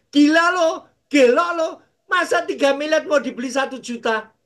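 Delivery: loud, high disbelieving cry; shocked outburst. Retold or imitated. Pastor scoffing at a buyer's tiny offer, loud and shocked. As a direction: blurt it out in shock at the price.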